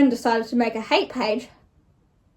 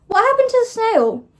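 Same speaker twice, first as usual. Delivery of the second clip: amused disbelief; 10 semitones higher, 4 dB louder than usual. Real, not acted. Mock-dramatic question about the snail.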